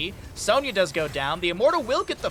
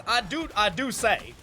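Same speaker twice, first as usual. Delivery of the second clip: slow, higher lightly voiced prim gentleman; game character quote; 4 semitones higher than usual. Streamer quoting a game character, lightly voiced and slow. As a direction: mimic the fussy character slowly. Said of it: "the one character that says".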